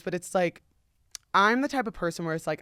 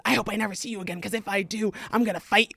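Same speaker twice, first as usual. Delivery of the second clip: ordinary delivery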